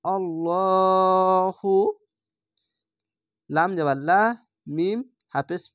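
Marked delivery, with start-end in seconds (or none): 0.0-1.7 আল্লাহু drawn out very slowly with a thick ল; slow tajweed chant of আল্লাহু, heavy ল; heavy lam recitation. Shown as a demonstration. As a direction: round the ল in আল্লাহু and hold it. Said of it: "ল উচ্চারণ হবে মোটা করে".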